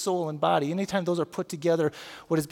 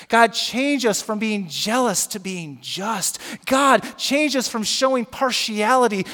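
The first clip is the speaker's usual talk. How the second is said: building spoken prayer; 4 dB louder, slower than usual. Acted. Pastor praying for his congregation, slow prayer building to loud.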